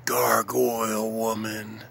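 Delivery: ordinary talk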